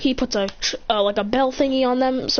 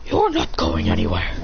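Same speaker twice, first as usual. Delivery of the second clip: much lower, menacing character voice; menacing blocker. Acted. Master Wu behind fleeing villain, low threat. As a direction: drop very low to block the escape.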